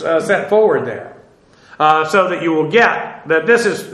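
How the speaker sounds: normal talking voice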